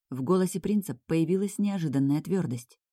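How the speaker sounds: usual speaking voice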